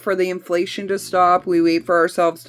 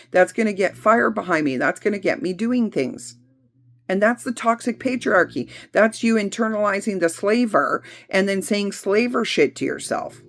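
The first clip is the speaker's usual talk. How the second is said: normal talking voice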